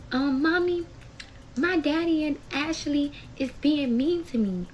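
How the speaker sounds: high-pitched imitation of a whining child; upset little child. Daughter calling mom about daddy and Ashley, imitated upset child. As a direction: play a small kid tattling to mommy. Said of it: "So she's crying".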